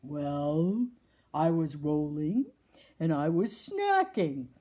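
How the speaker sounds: a hint of a lazy possum.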